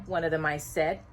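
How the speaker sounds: ordinary delivery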